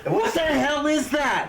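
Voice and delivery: high, slow outraged squeal. Retold or imitated. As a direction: squeal it in disbelief. Outrage at the parking meter.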